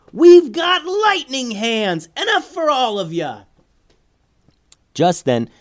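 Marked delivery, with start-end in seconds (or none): 0.1-3.4 loud, very high, drawn-out holler; Sam's boastful yell. A narrator's reading. Sam taunts the pirates after the lightning strike, high squealing yell. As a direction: crow it sky-high to anyone listening. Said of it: "Sam yelled to no one in particular".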